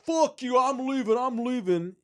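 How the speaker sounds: high, loud yelling; angry shout. Retold or imitated. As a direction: yell it at them, fed up. Drunk man yelling back.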